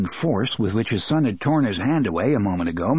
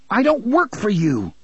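defiant; 9 semitones higher than usual. Acted. Jake standing up to his father, defiant. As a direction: throw it back at him. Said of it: "Jake said".

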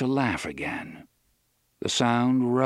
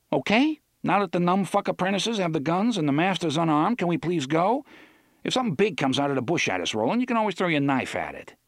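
impatient sarcasm; 7 semitones higher, faster than usual. Acted.

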